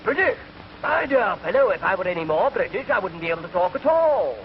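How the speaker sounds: high, affronted British bluster; caricature British gentleman. Acted. British diner to waiter, affronted high British voice. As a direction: answer indignantly in an exaggerated British accent. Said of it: "Man looked up and said".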